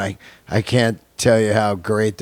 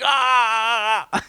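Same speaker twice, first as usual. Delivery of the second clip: high-pitched mock scream of pain; imitated scream; 10 semitones higher than usual. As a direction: imitate a man clawed by a cat, yelping high. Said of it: "He's like".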